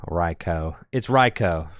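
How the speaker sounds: Raikou said as RYE-koh; corrected pronunciation. Shown as a demonstration. Host settling how to say the name, Raikou as RYE-koh. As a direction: end Raikou on "koh", long o. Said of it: "how do you pronounce Raikou".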